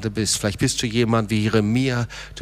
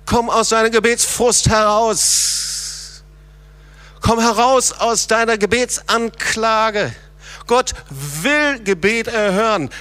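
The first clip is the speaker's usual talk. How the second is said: fervent preaching; 9 semitones higher, 4 dB louder than usual. Acted. Pastor urging the church to cry to the Lord, fervent preaching. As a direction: preach it, calling them out of the pit. Preacher rising to full force.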